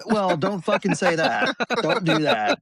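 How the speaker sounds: 笑着数落; laughing scold. Real, not acted. Exasperated dad, laughing.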